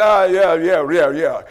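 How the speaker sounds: imitated brush-off, starting loud and falling away; dismissive brush-off. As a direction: wave him off with a run of falling yeahs. Man brushing off the greeting.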